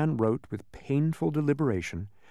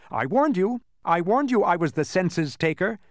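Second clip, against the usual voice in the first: raised, louder sneer; 5 semitones higher, 4 dB louder than usual. A narrator's reading. Census taker mocking his tricked victims, sneering. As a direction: gloat with open scorn. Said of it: "sneered the census taker".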